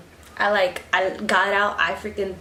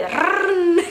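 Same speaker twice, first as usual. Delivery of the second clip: imitated high revving engine; engine roar; 9 semitones higher, 4 dB louder than usual. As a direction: do the truck finally pulling out.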